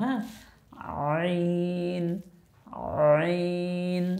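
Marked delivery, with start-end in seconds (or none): 1.2-4.1 আইন squeezed from the throat; throat-pressed letter. Shown as a demonstration. Teacher showing the throaty আইন. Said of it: "গলার মধ্যে চাপ দিয়ে উচ্চারণ করতে হয়".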